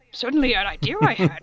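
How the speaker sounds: Yoda-like redo. Shown as a demonstration. Reader repeating the odd line as a wise little master. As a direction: redo the line as a quirky sage. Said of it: "repeats the line in a new voice".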